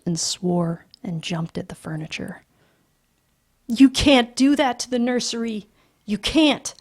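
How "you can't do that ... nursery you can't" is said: high, loud, frantic protest; children screaming at their parents; hysterical children. Acted. Children scream at father over nursery, frantic.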